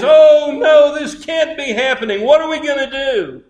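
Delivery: high, dramatised cry of a panicked mother; distraught cry. Retold or imitated. Mother to family over her bitten son, high distraught cry. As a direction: cry out high in acted dismay. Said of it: "And the mother cries".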